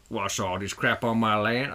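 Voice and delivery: lightly attempted rural American accent; rough American rancher accent. Host voicing a rancher finding debris, hazy American accent. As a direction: have a vague go at a New Mexico accent. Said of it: "I don't even know what his accent would be".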